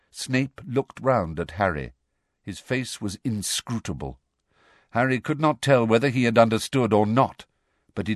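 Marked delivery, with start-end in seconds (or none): none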